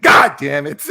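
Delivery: loud retold curse; retold outburst. Streamer retelling his anger at the Advance Wars delay, loud curse. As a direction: blurt it out loud in frustration. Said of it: "I'm like".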